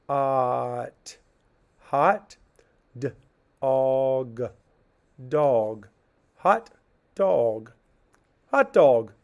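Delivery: teacher sounding out hot dog piece by piece; sounding out slowly. Shown as a demonstration. Teacher to class, sounding out each part. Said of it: "So I would call this".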